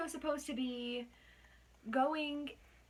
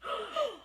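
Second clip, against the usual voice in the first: mock gasp; 11 semitones higher than usual. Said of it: "they all went".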